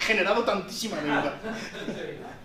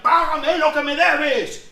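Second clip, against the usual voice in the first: higher, louder, slow threatening demand; choking demand; 6 semitones higher, 7 dB louder, slower than usual. Retold or imitated. Servant throttling fellow servant, louder angry demand. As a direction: squeeze the debt out of him. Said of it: "le cogió de la pechera y le ahogaba".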